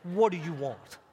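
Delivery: lightly voiced Italian mobster; The Godfather. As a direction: attempt a crime boss's Italian accent. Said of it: "Like The Godfather".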